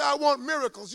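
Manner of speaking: loud, high plea for miracles; demanding cry. Pretend. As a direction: voice the hungry believer crying out. Congregant demanding miracles, preacher voicing.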